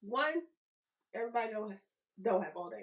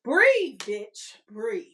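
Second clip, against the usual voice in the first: emphatic command; 10 dB louder, much slower than usual. Real, not acted. Host loudly ordering listeners to breathe. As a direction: blast the word at them. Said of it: "And the third is".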